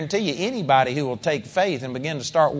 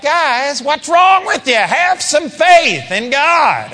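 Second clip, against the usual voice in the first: louder, higher, slow shouted rebuke; frustrated teacher shouting at dull students; exasperated Jesus; 9 semitones higher, 5 dB louder, much slower than usual. Retold or imitated. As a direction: scold the disciples in frustration. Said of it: "I believe it was like".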